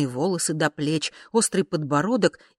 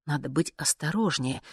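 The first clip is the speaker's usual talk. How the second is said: ordinary delivery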